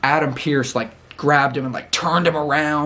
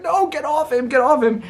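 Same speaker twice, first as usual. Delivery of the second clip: much higher, pleading imitation; mock frantic plea; 12 semitones higher than usual. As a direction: squeak out a panicked plea to stop. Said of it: "and Paul Heyman's like".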